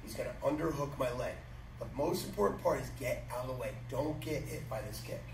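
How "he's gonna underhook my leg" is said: usual speaking voice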